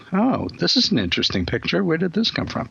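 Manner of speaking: pleasantly curious, acted lightly; mildly intrigued. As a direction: wonder aloud with curiosity, lifting the pitch. Voicing a curious reaction, contrasted with whining.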